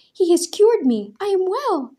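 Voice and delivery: high, loud ecstatic shout; joyful cry. A narrator's reading. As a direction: proclaim the miracle to everyone. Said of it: "a woman cried".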